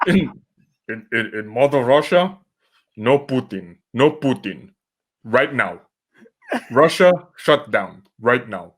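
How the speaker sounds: hammy fake Russian accent; clumsy mock Russian commands. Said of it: "I can't do a Russian accent".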